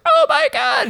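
very high-pitched pretend panic; hammed-up falsetto cry of terror; mock terrified scream. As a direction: squeal it like a doomed horror heroine. Voices heroine sucked down to hell.

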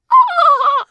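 high loud mocking cackle; smug laugh. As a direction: cackle in triumph. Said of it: "he'll go like".

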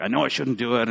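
low, soft, resigned aside; grudging admission. Retold or imitated. Someone told it's the wrong thing, soft grudging admission. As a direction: drop low and soft, half to yourself. Voicing a grudging rule-follower.

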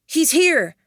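high, loud warning shout; calling out. Acted. Ashton shouts a warning to the team. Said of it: "Ashton called out".

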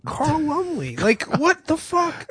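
high-pitched, laughing disbelief; incredulous. Real, not acted. Amazed at the cast, repeats the name.

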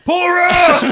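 high, loud mock yell. Richard Kiel yelling from the car window, loud high yell. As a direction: bellow it out the window.

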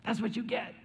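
high, gleeful gloat; mock gloating. Preacher mimics the crowd's smug glee at the villains' end, high and gloating. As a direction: crow over the bad guys' fall. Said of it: "We're like".

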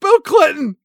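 high, loud joke yell; shouting a joke name; mock shout. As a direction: bellow the punchline.

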